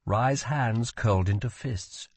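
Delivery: plain reading voice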